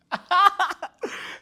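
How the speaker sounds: high, loud cackling laugh; burst of laughter. Real, not acted. Vlogger laughing at Rachel's scene, laughing hard.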